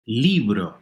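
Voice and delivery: "Libro" with LI stressed; stress on second-to-last syllable. Shown as a demonstration. Teacher presents the word for book, "Libro" stressed on LI. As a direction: punch LI, let bro drop in "Libro". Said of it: "second to last".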